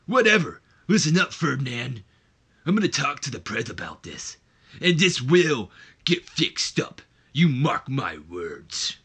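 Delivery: loud wrestler voice slowing to a threat; Ripper Savage voice. Acted. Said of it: "in that Ripper Savage voice that we all love".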